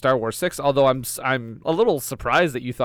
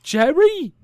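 mock call; 14 semitones higher, 6 dB louder than usual. Calling out to a cousin.

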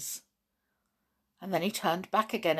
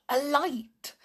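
higher-pitched exclamation of discovery; sudden surprise; 9 semitones higher than usual. Acted. Girl spotting a light in the cave, surprised.

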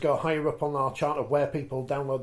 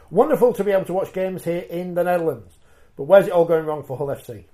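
reading a listener message; 3 semitones higher, 9 dB louder than usual. Shown as a demonstration.

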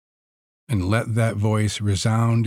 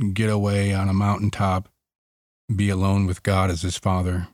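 normal talking voice

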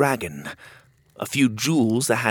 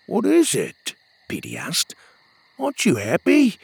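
lightly voiced playful sidekick; a hint of a cheeky companion; teasing friend voice; 8 semitones higher than usual. A narrator's reading. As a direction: rib your friend about his snoring. Petey asks, high.

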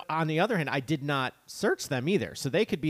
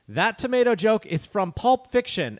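raised, emphatic voice reading out an exclamation point; mock yelling; 5 semitones higher, 4 dB louder than usual. Host reads listener's feedback to co-host, mock yelling. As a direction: read it like an indignant listener yelling. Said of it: "He's, like, yelling at us".

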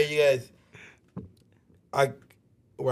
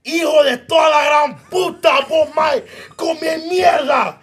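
imitated furious Salvadoran mom, high and loud; full-volume mock tirade; screaming mother; 9 semitones higher, 6 dB louder than usual. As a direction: scream the curses in Spanish, dragging out the last. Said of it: "She's screaming".

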